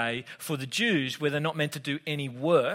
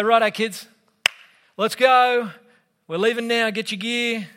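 put-on loud, higher call to the kids to get moving; dad hollering through the house that it's time to go; 6 semitones higher, 6 dB louder than usual. Father calling his kids to leave.

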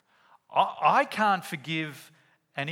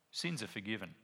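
said much softer, calm and low-key; soft quiet pronouncement; 4 semitones lower, 10 dB quieter than usual. Acted. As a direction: hand it over gently and quietly. Jesus quoted in retold scene.

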